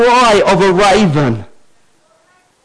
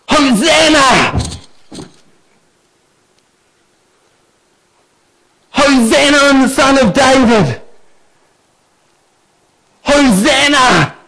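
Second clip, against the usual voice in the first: joyful shout; 4 semitones higher than usual. Pretend. Preacher imagining kids bursting into church, shouting Hosanna. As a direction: cry it out as the children would.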